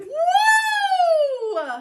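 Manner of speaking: loud, high, drawn-out "woo"; exuberant whoop. Real, not acted. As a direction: let out a long celebratory whoop. Whoop ending the fast song.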